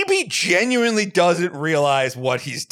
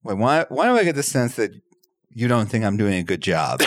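normal talking voice

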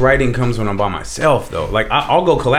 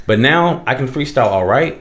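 normal talking voice

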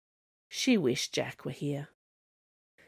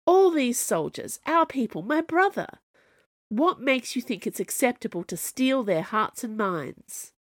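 accusing; 6 semitones higher than usual. Acted. Camilla accusing the Snow Queen, indignant. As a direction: press the charge hard.

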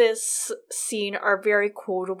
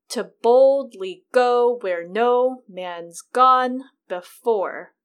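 slow beat with weight on "boldly", "go", "no", "gone"; iambic stress; 2 semitones higher, 6 dB louder, much slower than usual. Shown as a demonstration. Host shows iambs in pop culture, slow stressed "boldly", "go", "gone". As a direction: punch every second syllable of "To boldly go". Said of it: "So you recognize this line".

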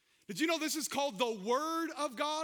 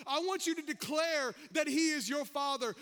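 ordinary talk, nothing marked

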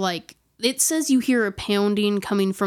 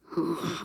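soft, low, airy exhale; creepy breathing sigh; 4 semitones lower, 8 dB quieter than usual. Retold or imitated. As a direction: breathe out like an unseen man. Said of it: "this creepy, like ... breathing".

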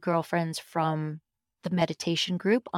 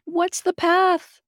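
much higher, slow, whiny plea; mock pleading; 12 semitones higher, 6 dB louder, much slower than usual. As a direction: beg the voice to explain.

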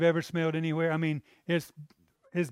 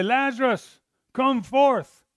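higher and louder, a ringing command to the grave; commanding call; 7 semitones higher, 6 dB louder, slower than usual. Retold or imitated. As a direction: call the dead man out boldly. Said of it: "He's gonna say".

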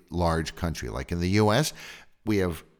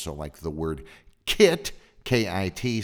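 ordinary delivery

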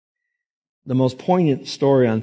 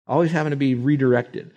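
normal talking voice

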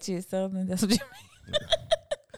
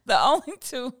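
higher-pitched, words through laughter; laughing; 11 semitones higher than usual. Real, not acted. Host cracking up at own dare question, laughing. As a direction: laugh hard, then drag out the words.

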